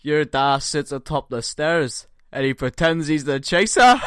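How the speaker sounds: put-on laughing Scottish accent. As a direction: Scots insult barely holding a laugh. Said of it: "read out in my best Scottish accent".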